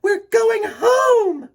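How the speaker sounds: high, loud and slow; Wendy shouts happily to her brothers; joyful shout. Acted. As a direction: shout it with pure joy. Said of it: "cried Wendy".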